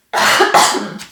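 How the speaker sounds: loud breathy hacking cough; harsh cough. Real, not acted. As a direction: cough hard from a sore throat. Sick vlogger coughing.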